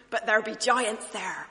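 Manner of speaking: loud, mock broad Bristol accent; put-on West Country Bristol voice. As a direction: call it out like a Bristolian. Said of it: "saying that in a broad Bristol accent".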